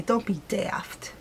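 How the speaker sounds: slow muttered whisper of a scold. Retold or imitated. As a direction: hiss it slowly under your breath. Mock scolding under breath.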